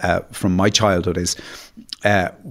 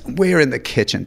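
normal talking voice

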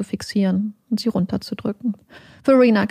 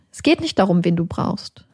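usual speaking voice

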